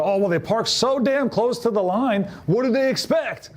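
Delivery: slow, high, huffy self-justifying complaint; indignant excuse. Retold or imitated. Driver who dented a door, blaming the other car indignantly. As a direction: pitch it up in mock outrage at the parked car.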